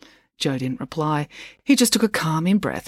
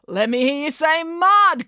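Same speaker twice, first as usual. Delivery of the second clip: high, drawn-out hype-man call; crying out; 11 semitones higher, slower than usual. A narrator's reading. Brad to the whole crowd, crying out. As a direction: whip the crowd up. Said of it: "cried Brad".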